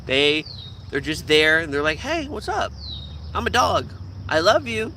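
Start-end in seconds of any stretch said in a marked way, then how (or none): 2.0-4.9 lightly voiced sweet, simple dog; innocent dog voice. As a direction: greet like a guileless pet. Voicing a friendly innocent dog.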